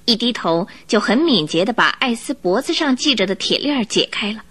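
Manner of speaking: ordinary talk, nothing marked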